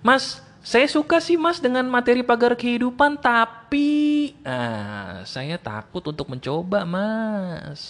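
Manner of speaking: hesitant fan. Retold or imitated.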